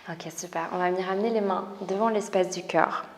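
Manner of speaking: calm, breath-paced cues with whispered breathing counts; soothing yoga teacher guiding movement; guided yoga instruction. Acted. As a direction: lead the class through each breath and stretch. Leads yoga breathing session.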